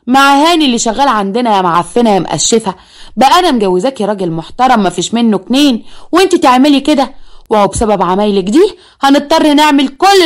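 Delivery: furious mother berating her daughter for the scandal; angry mother. Acted. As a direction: tear into the daughter with rising fury. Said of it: "وقالت لها بغضب".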